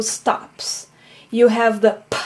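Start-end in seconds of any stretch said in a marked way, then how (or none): 2.1-2.2 p as a bare puff from the lips, no vowel; p popped without any voice; voiceless p. Shown as a demonstration. Teacher showing the bilabial stop, p as a voiceless puff. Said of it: "which is voiceless".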